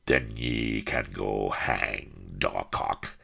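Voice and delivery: slow, low pirate growl; restrained roar. A narrator's reading. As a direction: growl out the insult, drawn out. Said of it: "roared Firebeard".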